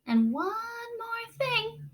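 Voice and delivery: high, slow, drawn-out warning; slowly. Acted. Mother slowly preparing Jameson for bad news. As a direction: stretch it out to build suspense. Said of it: "his mother said slowly".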